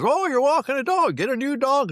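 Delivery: mimicking nosy neighbor. Nosy neighbor gawking at a dog walker, high mock voice. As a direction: squeak it out like a busybody.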